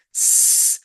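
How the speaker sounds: "s-" as a sustained voiceless hiss; long isolated sibilant for "s-"; soft C sound. Shown as a demonstration. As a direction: hold the "s-" with no vowel. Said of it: "suena así".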